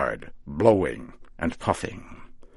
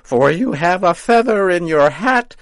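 cackling witch farewell; 12 semitones higher, 10 dB louder than usual. A narrator's reading. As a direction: send him off laughing at him.

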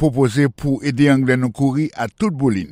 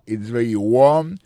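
slow with warm stretched and louder; slow model sentence; 3 dB louder, slower than usual. Shown as a demonstration. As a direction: lean on warm slowly. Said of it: "teacher models the sentence". (mete fòs sou warm dousman)

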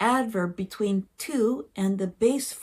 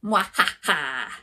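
evil laugh; 6 semitones lower than usual. Acted. Gloating after tricking him.

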